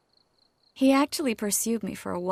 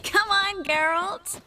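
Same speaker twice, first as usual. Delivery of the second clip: playful call; 10 semitones higher, 3 dB louder, slower than usual. Acted. Tipsy Triss beckons Geralt to follow.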